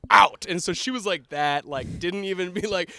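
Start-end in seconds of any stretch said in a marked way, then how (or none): 0.1-0.5 loud, higher mock teacher shout; teacher's angry order. Mean art teacher kicking a kid out, loud and angry. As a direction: imitate a furious teacher throwing someone out. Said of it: "And she goes".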